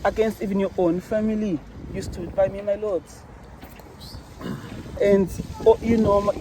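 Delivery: ordinary talk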